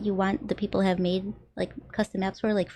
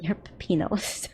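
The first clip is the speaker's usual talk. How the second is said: breathy unvoiced whisper; 4 dB quieter, slower than usual. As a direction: whisper it close and secret. Teasing whisper to stream.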